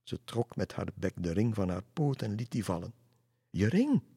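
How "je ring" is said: called out higher and louder; calling out. Acted. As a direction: call after the flying dove. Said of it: "riep Lisse nog".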